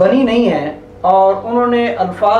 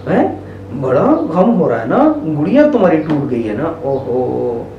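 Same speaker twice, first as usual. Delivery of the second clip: sympathetic sad; 6 semitones lower than usual. Shown as a demonstration. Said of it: "जब वो गमगीन होगी तो आप क्या कहेंगे".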